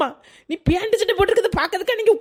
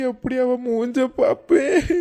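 mock sobbing; 6 semitones lower, slower than usual. Husband weeping over wife.

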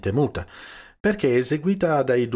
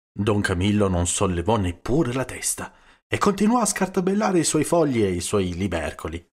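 usual speaking voice

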